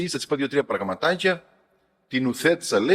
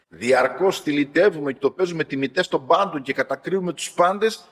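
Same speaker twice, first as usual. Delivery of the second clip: ordinary talk, nothing marked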